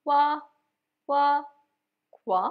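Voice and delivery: কোয়া as one isolated "gwa", repeated with pauses; broken-down syllable drill. Shown as a demonstration. Teacher helping learners who find guava hard, syllable drill. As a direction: drill কোয়া alone, short, leaving gaps. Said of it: "ভেঙে ভেঙে practice করতে পারেন".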